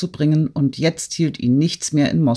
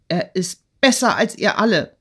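higher-pitched defiant outcry, restrained volume; narrated cry; 5 semitones higher than usual. A narrator's reading. Girl jumping up to defend her lover, high defiant cry. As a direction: throw it back at them, pitch raised. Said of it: "schrie Natalie aufspringend".

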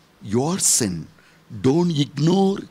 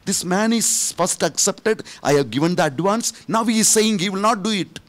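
upset pleading; 8 semitones higher, faster than usual. Retold or imitated. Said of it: "I said".

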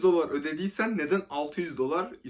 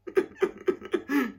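laughing; 12 semitones higher than usual. Real, not acted. Laughing while telling the story.

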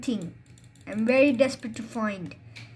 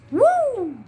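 high, loud woo; cheer; 9 semitones higher, 4 dB louder than usual. Real, not acted. Gamer celebrating getting his items back, whooping. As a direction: let out a happy whoop.

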